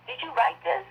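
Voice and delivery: high, astonished questions; retold amazement. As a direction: imitate her disbelief. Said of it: "it was like".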